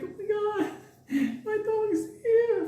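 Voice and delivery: put-on very high old-lady squeal; hammed-up old woman's delight; overjoyed old lady. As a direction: imitate her in a tiny high voice. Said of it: "the old lady's like".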